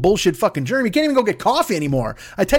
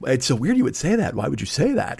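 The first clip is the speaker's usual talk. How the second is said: lower, softer, defensive lawyer; Nathan Thurm impression; 6 semitones lower, 4 dB quieter than usual. Comic doing the nervous lawyer character, lower softer defensive. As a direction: deflect it quietly, all defensive. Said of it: "Martin Short's lawyer character".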